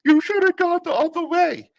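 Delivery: high snotty mocking voice; mocking coworker. Coworker needling the busboy with the tub, high mocking voice. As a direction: needle him in a high, bratty voice. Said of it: "just goes".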